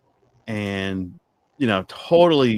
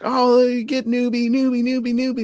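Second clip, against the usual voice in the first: excited cheering; 13 semitones higher, 6 dB louder than usual. Retold or imitated. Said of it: "We're like".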